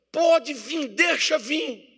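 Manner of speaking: high, drawn-out taunt; defiant challenge. Acted. Believer inviting problems to come, mock-defiant. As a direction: call trouble on in a high mock voice.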